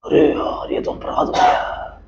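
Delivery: whispered dismay; whispered guilty realisation; horrified whisper. Acted. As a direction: breathe it out in guilty alarm. Guilty inner thought.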